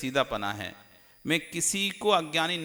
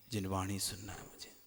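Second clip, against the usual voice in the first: quiet inner resolve; 7 semitones lower, 11 dB quieter than usual. Retold or imitated. Listener's inner resolve voiced.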